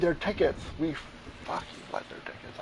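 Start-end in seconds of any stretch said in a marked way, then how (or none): 1.4-2.6 whisper. Real, not acted. Whispered repeat of the line.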